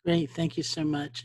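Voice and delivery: ordinary talk, nothing marked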